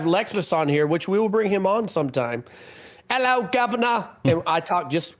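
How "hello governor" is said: hammed-up British accent, higher; a cheeky mock Cockney greeting, pitched up. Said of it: "I have a very good British accent".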